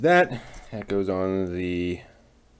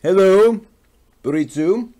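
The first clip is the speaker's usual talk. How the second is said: loud, high hello to a viewer; big cheerful hello to the chat; loud excited greeting; 10 semitones higher, 8 dB louder, slower than usual. Real, not acted. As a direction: call out hello with delight.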